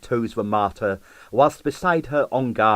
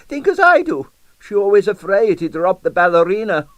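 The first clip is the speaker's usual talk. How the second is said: put-on amused old Russian husband; high, loud Russian old man; Russian accent; 10 semitones higher, 7 dB louder than usual. A narrator's reading. Husband dismisses jealousy.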